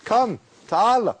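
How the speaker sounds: put-on boy selling socks calling people over in a loud, high voice; street hawker calling. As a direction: beckon customers over the crowd noise. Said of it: "he cries out".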